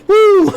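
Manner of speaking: loud high whoop breaking into a laugh. Retold or imitated. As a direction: let out a high laughing woo as you jump. Retelling the jump.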